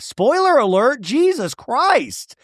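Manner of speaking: high-pitched, drawn-out exasperation; exasperated. Real, not acted. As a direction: let it soar in disbelief, stretching the end. Exasperated aside on the example.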